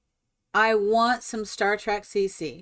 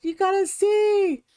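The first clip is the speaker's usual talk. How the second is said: much higher, louder and slow; excited insisting; 11 semitones higher, 3 dB louder than usual. Retold or imitated. Mother insisting her daughter see the build, much higher and excited. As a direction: stretch it out, bursting with news. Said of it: "I was like".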